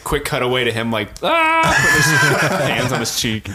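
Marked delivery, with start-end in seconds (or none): 1.2-1.8 short high cartoon scream; mock movie-kid shriek. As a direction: let out a high, startled "ah". Mimics startled face-slapping movie scream.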